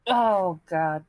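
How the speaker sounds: normal talking voice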